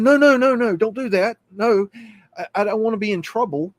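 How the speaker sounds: put-on high, loud frightened kid begging; panicked pleading. Scared kid begging the operator not to call police, high and loud. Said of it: "And I said".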